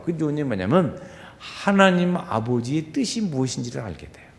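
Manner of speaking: usual speaking voice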